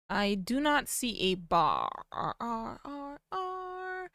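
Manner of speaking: slow, soft, trailing into repeated R sounds; playful echoing tail on "bar"; drawn-out bar. Pretend. Playfully stretching out the word bar.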